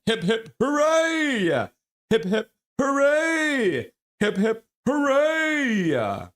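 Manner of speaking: high, slow, sung-out hoorays; three cheers. Real, not acted. Thanking the donor with three high, drawn-out cheers. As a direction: cheer long and loud for the donor.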